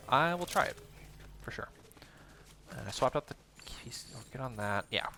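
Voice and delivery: normal talking voice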